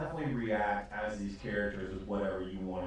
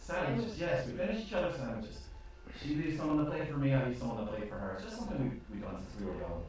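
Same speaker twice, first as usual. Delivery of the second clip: higher, quick sing-song twin; twin barmaid; 5 semitones higher, faster than usual. Acted. Twin barmaid explaining their sandwich habit, higher and quick. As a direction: chirp it out quickly. Twins finish sentences.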